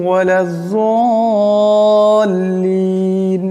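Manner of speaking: slow melodic tilawah; slow measured Quranic recitation. Shown as a demonstration. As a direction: recite slowly with a drawn-out Arabic chant. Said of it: "শুদ্ধভাবে উচ্চারণটা হবে".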